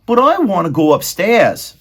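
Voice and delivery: put-on high, loud servant-girl voice; Meg's voice. Maid Meg answering Roscuro, high and loud. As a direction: squeak it out as the simple maid.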